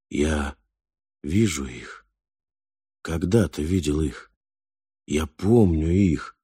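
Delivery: halting, with long pauses between phrases; slow, hesitant recollection, groping for each phrase; запинаясь, с паузами. A narrator's reading. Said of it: "запинаясь". (говорить медленно, запинаясь)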